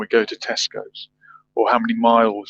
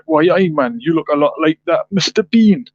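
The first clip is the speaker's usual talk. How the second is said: put-on a rough stab at a Geordie accent, higher and louder; attempted Geordie accent; 6 semitones higher, 5 dB louder than usual. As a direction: imitate a Newcastle fan spotting Mister Bean, in a rough Geordie accent. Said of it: "I can't do the accent, but something like".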